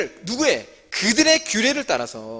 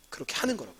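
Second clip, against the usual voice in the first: quiet repeat; 5 semitones lower, 12 dB quieter, faster than usual. Real, not acted. Teacher repeats the point.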